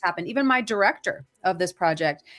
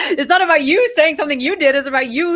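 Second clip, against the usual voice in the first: laughing; 9 semitones higher, 7 dB louder than usual. Real, not acted. Laughs while making her point.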